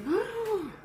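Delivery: delighted gasp. Real, not acted. Tasting food, delighted.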